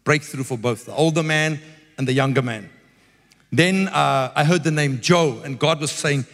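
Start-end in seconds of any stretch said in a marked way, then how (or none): none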